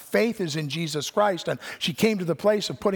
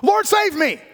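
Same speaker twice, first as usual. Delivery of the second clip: panicked cry, much higher and louder; desperate cry; 14 semitones higher, 6 dB louder, much slower than usual. Retold or imitated. Peter sinking in the waves, crying out in panic. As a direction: cry out like a drowning man. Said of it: "He's like".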